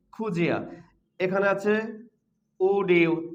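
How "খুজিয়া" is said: "খুজিয়া" with d and y fused into j; linked pronunciation. Shown as a demonstration. Teacher answers what this becomes, "খুজিয়া" with a j link. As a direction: run it together as khu-jia, "খুজিয়া". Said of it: "কি হবে".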